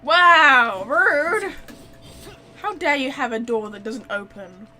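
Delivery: ordinary talk, nothing marked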